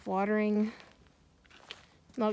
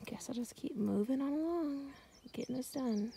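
whispering; 4 semitones higher, 7 dB quieter than usual. Real, not acted. Tired sigh then whisper.

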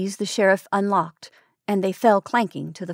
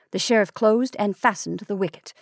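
plain reading voice

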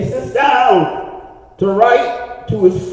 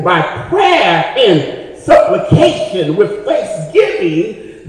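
declaimed scripture; 5 dB louder than usual. Real, not acted. Preacher reciting Philippians to the congregation, declaimed scripture. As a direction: preach the verse, lifting the big words.

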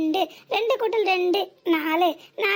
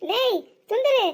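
raised voice, calling a name across the house; 3 semitones higher than usual. Acted. Wife calling out to her husband, raised voice. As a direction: call him from another room.

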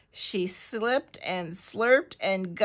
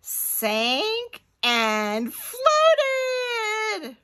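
very slow, high, drawn-out words; long suspenseful narrator's build; stretched-out suspense; 13 semitones higher, 3 dB louder, much slower than usual. A narrator's reading. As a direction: stretch each word, soaring on "floated". Dramatic climax of the launch.

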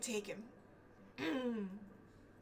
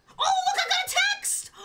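very high, loud squeal; excited exclamation; 13 semitones higher, 6 dB louder than usual. Real, not acted. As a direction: burst out like it's thrilling news. Excited at a game message.

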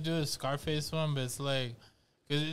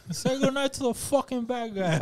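higher, hammed-up Scarface line; a laughably bad Tony Montana; goofy gangster voice pitched up; bad Scarface impression; 8 semitones higher than usual. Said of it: "the worst Scarface impression ever".

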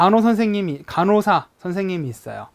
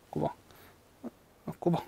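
dozing-off demo; 12 dB quieter, faster than usual. Shown as a demonstration. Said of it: "꾸벅꾸벅".

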